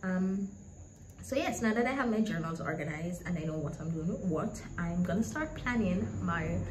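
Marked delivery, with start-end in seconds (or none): none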